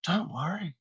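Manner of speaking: imitating his partner calming him down; mock soothing reassurance. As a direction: hush him like a worried friend. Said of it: "and you're like".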